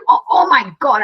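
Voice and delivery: loud, high hammed-up grunt of effort; mock strain. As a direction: pretend the bag weighs a ton, straining out loud. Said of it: "And I did".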